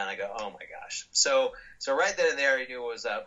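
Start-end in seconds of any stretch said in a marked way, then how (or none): 0.4-1.1 breathy whisper of realisation; whispered dismay. Retold or imitated. Coach lists what he forgot, whispered dismay. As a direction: whisper it as it sinks in.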